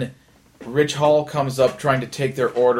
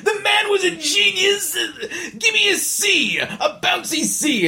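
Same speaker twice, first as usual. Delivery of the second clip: Irving Cohen. Retold or imitated. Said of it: "Martin Short's like".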